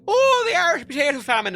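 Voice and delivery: high, loud mock-Irish jeer; mocking Irish accent. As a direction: taunt loudly in a hammed-up Irish accent. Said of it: "everyone mocking me".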